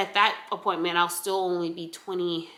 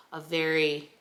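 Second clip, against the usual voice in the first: ordinary delivery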